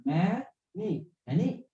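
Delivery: "many" broken into ma, pause, nee; syllable by syllable. Shown as a demonstration. Teacher showing a word with two syllables, syllable by syllable. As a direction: softly split "many" into two separate beats. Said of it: "So two syllable".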